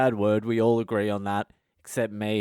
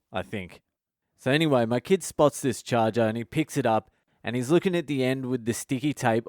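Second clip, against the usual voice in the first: usual speaking voice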